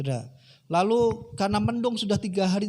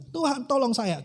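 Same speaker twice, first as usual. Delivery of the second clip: acted plea; 6 semitones higher than usual. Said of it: "dia minta pertolongan Tuhan".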